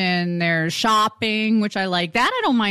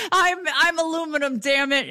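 higher, huffy mock insistence; mock stubborn American; 6 semitones higher than usual. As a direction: stand your ground in a playful huff. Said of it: "It was".

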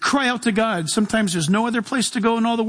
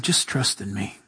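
very low and soft; barely above a breath, calm and sure; still small voice; 14 semitones lower, 6 dB quieter than usual. Retold or imitated. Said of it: "you hear him saying".

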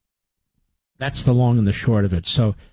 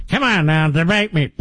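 mock challenger; 4 semitones higher than usual. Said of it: "They say in between drinks".

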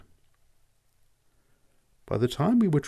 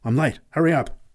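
rising urgent call, higher by the end; 4 semitones higher, 4 dB louder than usual. Acted. Late Pat hurrying the boy onto the cart, urgent and rising. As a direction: hurry the boy aboard, building up. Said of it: "exclaimed urgently".

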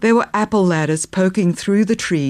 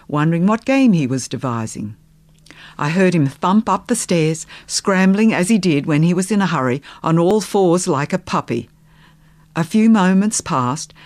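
ordinary delivery, nothing marked

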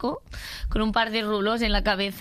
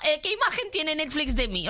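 high, indignant outburst at Netflix; exasperated rant; 5 semitones higher than usual. Real, not acted. Guest ranting about Netflix suggestions, high indignant outburst. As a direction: throw up your hands, much higher.

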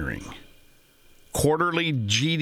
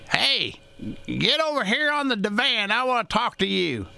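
high-pitched imitation of grandma calling him over; grandmother calling; 9 semitones higher than usual. Grandmother summoning her grandson to the divan, high imitating voice. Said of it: "My grandmother used to say to me".

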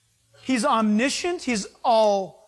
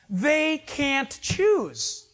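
slow emphasis; 4 semitones higher, much slower than usual. Real, not acted. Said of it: "slowed emphasis".

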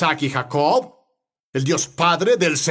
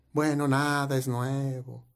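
low, soft, slow resignation; dragging, defeated reply; mock downcast; 4 semitones lower, 7 dB quieter than usual. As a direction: imitate a glum person with nothing to report. Mimics the downcast person again.

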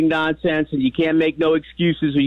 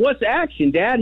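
put-on child asking; 8 semitones higher than usual. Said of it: "I'm yelling".

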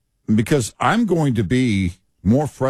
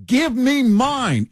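high, drawn-out mock demand; grabby demand; 14 semitones higher, slower than usual. Mocking voters who want their handout, grabby. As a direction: stretch it slowly, up high and greedy. Voices greedy public wanting handouts.

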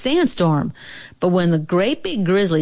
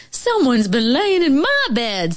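higher-pitched mama bear; middle-sized motherly bear voice; middle-voiced mama bear; 7 semitones higher than usual. A narrator's reading. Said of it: "in her middle voice".

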